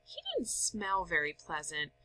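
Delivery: ordinary delivery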